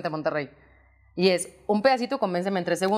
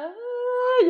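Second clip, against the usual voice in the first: long, high imitated wail; mock crying wail. Singer retells coming out to her waiting family, mock crying wail. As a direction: imitate a drawn-out tearful cry.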